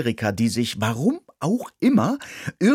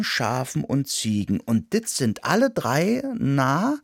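purring cat; 6 semitones higher than usual. Acted. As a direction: purr it smugly like a cat. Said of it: "schnurrte jetzt ausgerechnet Clotilde".

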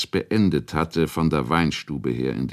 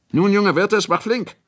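high, louder impatient order; impatient urging; 5 dB louder than usual. A narrator's reading. Boss to the boy who didn't move, impatient urging. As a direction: hurry him along, pitched right up.

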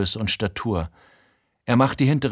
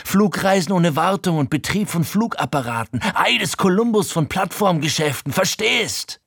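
sehr hoch, Stimme kippt fast über; so hoch, dass die Stimme zu brechen droht; überschlagende Stimme; 9 semitones higher, 3 dB louder than usual. Acted. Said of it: "Seine Stimme überschlägt sich". (Frankie redet sich in Rage, Stimme überschlägt sich)

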